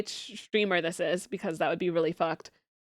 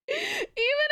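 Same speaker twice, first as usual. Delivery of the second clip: very high voice through giggles; laughing; 14 semitones higher than usual. Real, not acted. Streamer giggles about her own jokes.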